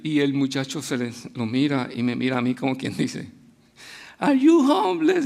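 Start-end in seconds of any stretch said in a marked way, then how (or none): 4.2-5.2 put-on high, childlike question; high little-boy voice asking innocently; little son's voice. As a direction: ask it like a curious small boy. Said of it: "le vuelve y le pregunta".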